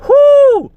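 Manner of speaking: high, loud exasperated whoop. Real, not acted. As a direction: whoop it out in disbelief. Outraged outburst about developers.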